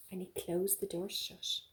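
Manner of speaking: ordinary delivery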